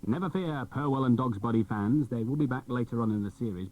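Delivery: normal talking voice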